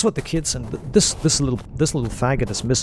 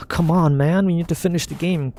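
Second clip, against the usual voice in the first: imitated teammate urging; 6 semitones higher than usual. Voicing impatient teammates urging him on.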